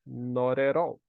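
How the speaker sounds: Not at all with t said like a quick r, noray-all; Not at all with flapped t's; Not at all run together, t like r. Shown as a demonstration. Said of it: "O T fica com som de R".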